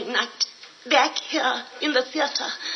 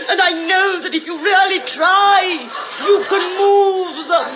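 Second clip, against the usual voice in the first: rising to a loud rallying cry; rousing shout; 6 semitones higher, 6 dB louder than usual. Acted. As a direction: build it into a triumphant shout. Rousing encouragement, building.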